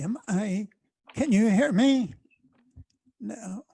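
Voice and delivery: normal talking voice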